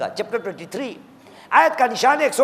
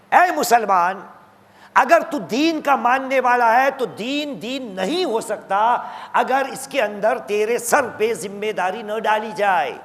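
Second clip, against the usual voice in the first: usual speaking voice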